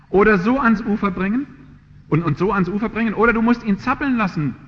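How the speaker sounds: fervent preaching. Acted. Preacher urges the congregation with loud fervent preaching. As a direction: drive the point home, preaching hard.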